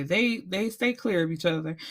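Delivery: usual speaking voice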